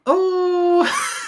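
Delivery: high, hooting Irish "oh"; Graham Norton. Shown as a demonstration. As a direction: whoop it in a comic Irish accent. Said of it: "like Graham Norton".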